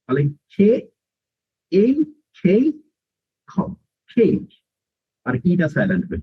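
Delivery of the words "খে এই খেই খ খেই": খেই sounded out piece by piece; long A sound. Shown as a demonstration. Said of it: "আগের এ কে long sound দিবে".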